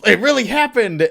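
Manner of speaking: high, loud mock amazement; excited cry. Fan voicing Billy showing his friend, excited yell. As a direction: burst out in disbelief. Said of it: "And he's like".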